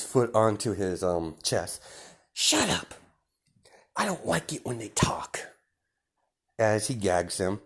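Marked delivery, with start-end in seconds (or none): 2.3-5.4 menacing villain Deku. Acted. Deku telling the villains they angered his boss, high and taunting. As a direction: lord it over them, savouring each threat.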